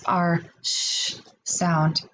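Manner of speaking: sh held long and slow; drawn-out sh sound. Shown as a demonstration. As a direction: stretch the sh sound out slowly. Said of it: "That's one of our blends".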